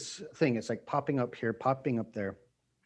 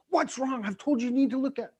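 acting angry; 11 semitones higher than usual. Dad after daughter almost gets hit, high angry scolding. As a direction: act out fear coming out as anger, pitched way up. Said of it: "I'm acting angry, but it's a fear".

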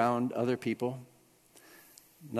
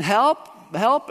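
high, loud mock cry for help; retold panicked cry; 6 semitones higher, 5 dB louder than usual. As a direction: act out calling through a locked door. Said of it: "I'm panicking".

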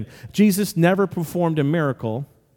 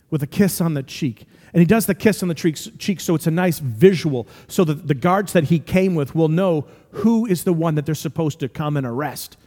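ordinary talk

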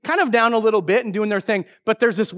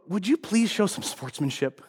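lower, softer retold polite plea; restrained reproach; 7 semitones lower, 7 dB quieter than usual. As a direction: replay a restrained request to a rowdy fan. Dad reproaching the yelling fan.

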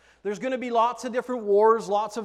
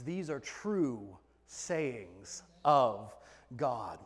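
slow solemn; 9 semitones lower, 6 dB quieter, much slower than usual. Acted. Repeated solemn slow quote.

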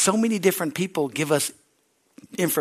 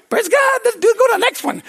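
excited praise; 7 dB louder than usual. Retold or imitated. His excited inner reaction.

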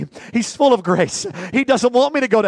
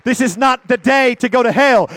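ordinary delivery

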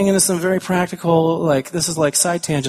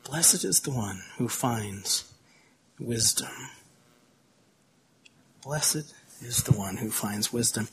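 hushed reflective repeat; 4 semitones lower, 12 dB quieter, slower than usual. Real, not acted. Pastor repeats verse reflectively.

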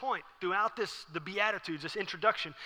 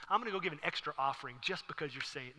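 softly sarcastic pious thanks; understated, pretend-touched praise; mock gratitude; 8 dB quieter than usual. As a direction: quietly feign being blessed by the insult. Mock gratitude during a heated fight.

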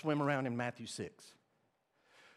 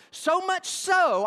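drawn-out, much higher and louder; emphatic shout; 13 semitones higher, 8 dB louder, much slower than usual. Real, not acted. Preacher pressing the point to the church, slow loud cry. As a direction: stretch it out and lift it high.

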